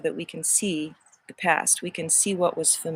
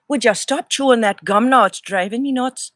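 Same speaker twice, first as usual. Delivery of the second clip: scolding voice; 5 semitones higher, 9 dB louder than usual. Pretend. Scolding someone chewing gum.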